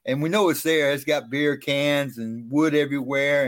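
ordinary talk

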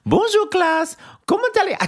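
very high, mock-French teacher voice; a squeaky French teacher imitation; imitating a French teacher. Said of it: "[name]'s imitation of it".

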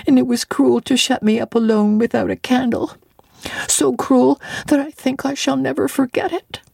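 put-on a little girl confessing her fear of the ghost; hurt frightened child. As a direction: Jane telling the doctor about the red room. Child Jane voiced.

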